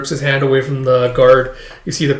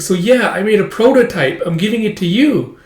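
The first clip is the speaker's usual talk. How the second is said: put-on higher-pitched child voice; eager little girl; 7 semitones higher than usual. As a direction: pipe up like a kid showing off. Child presents her prototype to maid.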